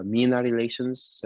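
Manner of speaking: ordinary delivery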